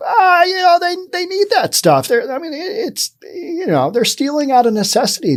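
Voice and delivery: high-pitched mock sympathy; mocking residents' excuse. Podcaster mimicking local residents who excuse theft, mocking high voice. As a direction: imitate excuse-makers in a high, pleading voice.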